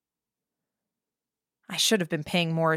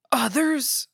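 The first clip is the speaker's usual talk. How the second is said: sharp startled gasp, pitched up; 7 semitones higher than usual. A narrator's reading. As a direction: gasp the word in alarm. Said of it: "the fishmonger gasped".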